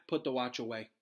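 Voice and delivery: usual speaking voice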